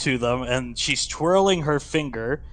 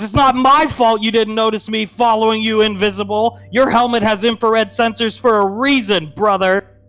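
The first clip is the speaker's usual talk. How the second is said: put-on higher, louder girlish voice; a smug little sister talking back; bratty little sister; 7 semitones higher, 5 dB louder than usual. Girl mocking her brother's helmet sensors, bratty. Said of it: "The young girl turns to him and she says".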